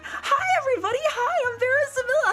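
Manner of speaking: very high, bright cutesy greeting; high-pitched kawaii VTuber voice; squeaky kawaii voice. Shown as a demonstration. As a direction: chirp it out like an excited anime girl. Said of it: "Imagine if I, like, kept doing that".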